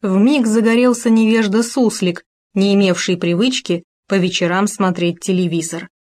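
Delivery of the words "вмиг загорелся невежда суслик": ordinary delivery, nothing marked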